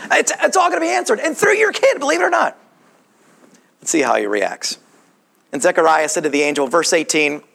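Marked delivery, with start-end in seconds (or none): 0.1-2.6 high-pitched, excited breaking of good news; excited announcement. Acted. Angel tells Zechariah his prayer is answered, excited announcement. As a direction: blurt out amazing news high and quick.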